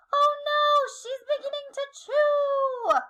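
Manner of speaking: high, loud panicked cry; crying out in alarm. Acted. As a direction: yelp it as the giraffe bites down. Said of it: "I cried out".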